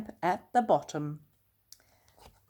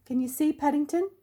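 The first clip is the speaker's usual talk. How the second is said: ordinary delivery, nothing marked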